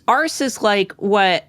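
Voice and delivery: ordinary talk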